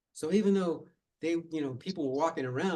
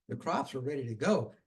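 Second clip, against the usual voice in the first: usual speaking voice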